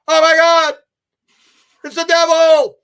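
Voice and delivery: high, loud panicked shout of a preacher; terrified cry. Retold or imitated. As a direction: cry out as if facing the devil. Regressed preacher sees the devil.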